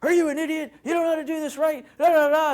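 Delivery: high-pitched mock boss rant; yelling boss. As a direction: berate the employee like an angry boss. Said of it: "bangs on your door and says".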